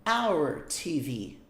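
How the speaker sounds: "our T V" said slowly, word by word; slow model phrase. Shown as a demonstration. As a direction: spell out "our T V" slowly for learners. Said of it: "eu falo que é". ("our T V" devagar, palavra por palavra)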